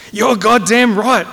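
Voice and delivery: slow, high, loud roar. Acted. Colonel confirming a cadet's answer at hearing, roaring. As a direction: roar each word in angry triumph. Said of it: "roared the colonel".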